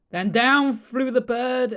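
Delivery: ordinary delivery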